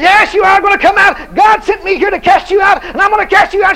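high, loud, crying rebuke; shouting rebuke. Retold or imitated. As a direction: shout the demon down. Preacher shouts back at the demon.

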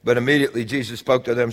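ordinary delivery

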